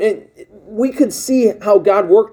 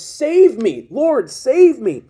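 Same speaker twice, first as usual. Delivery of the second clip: retold cry for help; 6 semitones higher, much slower than usual. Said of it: "he cried out to Jesus".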